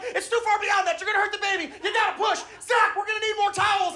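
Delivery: put-on high, frantic child voice; urgent little-girl midwife. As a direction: mimic a kid running a delivery room. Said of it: "My youngest is like".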